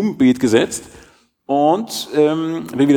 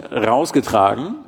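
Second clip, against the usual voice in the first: normal talking voice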